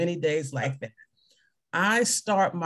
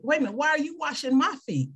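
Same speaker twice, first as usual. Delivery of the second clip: mock disbelief; 7 semitones higher, faster than usual. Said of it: "his disciples couldn't understand it".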